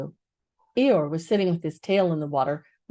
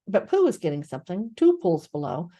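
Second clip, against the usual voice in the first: plain reading voice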